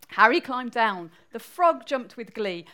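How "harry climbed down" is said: ordinary delivery, nothing marked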